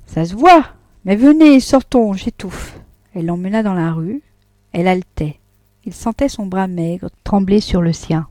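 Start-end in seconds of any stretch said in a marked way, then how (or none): 0.1-2.8 higher and louder, urgent, then fading breathless on the last word; agitated. A narrator's reading. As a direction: hurry him out, choking with agitation. Vatnaz étouffe d'émotion. (agitée et pressante, puis à bout de souffle)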